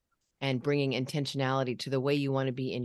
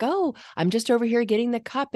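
nervous chatter; 7 semitones higher than usual. Retold or imitated. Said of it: "that nervous, like, trying to keep everybody happy".

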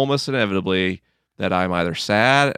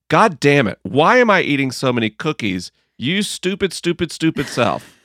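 frustrated self-scolding; 5 semitones higher, 3 dB louder than usual. Retold or imitated. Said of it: "what leads us to frustration is to say".

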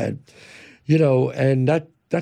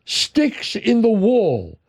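slow emphatic; 6 semitones higher, much slower than usual. Real, not acted. Dramatic emphasis.

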